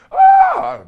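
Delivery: high, loud delighted ah; delighted gasp. Real, not acted. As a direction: gasp with joy at the pizza. Opening the pizza box.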